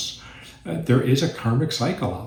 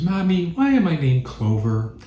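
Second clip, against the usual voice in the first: put-on child voice; 7 semitones higher than usual. Child asking mother in joke.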